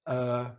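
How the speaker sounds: er with the jaw dropped; model er. Shown as a demonstration. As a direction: drop the jaw for er. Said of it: "dropping the jaw".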